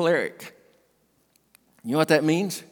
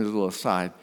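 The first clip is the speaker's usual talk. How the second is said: usual speaking voice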